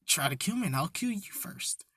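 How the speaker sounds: soft, breathy whispered threat; quiet, chilling whisper at knifepoint; menacing whisper. Retold or imitated. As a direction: breathe the threat right into his ear. Izuka's silent threat at knifepoint.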